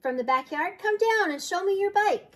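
put-on high, lifted call to a child; storybook grandma calling up from outside. A narrator's reading. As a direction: call them down to play. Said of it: "called Grandma Sal".

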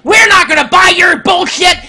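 defiant shout. Retold or imitated. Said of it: "You said".

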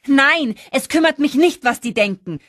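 loud, high defiant yell; bellowing. A narrator's reading. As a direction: roar it back at your friend. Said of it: "brüllte Holly so laut". (lautes, hohes Brüllen)

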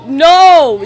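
one loud furious no; blasting no of disbelief; outraged shout. Real, not acted. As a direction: yell it at the screen. Outraged at Max again.

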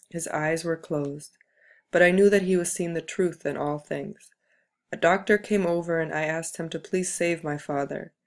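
ordinary delivery, nothing marked

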